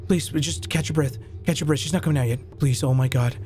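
ordinary delivery

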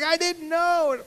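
a hint of a whiny big sister. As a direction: plead it. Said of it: "She's like".

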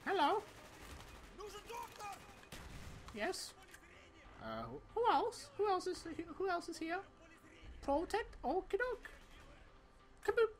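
high, bouncy put-on soldier voice; Mason mode. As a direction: play a cheerful, goofy Mason picking off enemies. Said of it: "Mason, activate mode".

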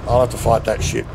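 ordinary delivery

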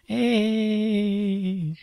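high, airy ghostly "Hey"; a spooky Canadian ghost greeting. Acted. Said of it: "Canadian ghost".

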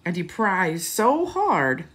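lively storybook read-aloud; rising to a high, loud finish; reading to children. A narrator's reading. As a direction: read it with big storybook energy. Storybook narration.

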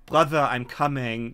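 usual speaking voice

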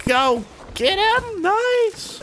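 playful high-pitched cheer; squeaky cheer. Pretend.

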